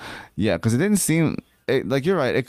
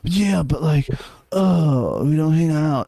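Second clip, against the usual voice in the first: higher-pitched whiny complaint; mock whining; 6 semitones higher, slower than usual. Cohost mimics a man complaining to his girlfriend, mock whiny. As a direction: whine it out, imitating a complainer. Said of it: "just to be his whiny".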